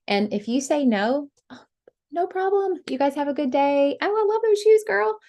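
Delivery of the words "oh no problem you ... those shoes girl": bright, high, cheery farewell with a gush over the shoes; breezy compliment. Shown as a demonstration. Seller walking out breezily, cheery high gush. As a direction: sweep out gushing compliments. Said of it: "Walk out saying compliments".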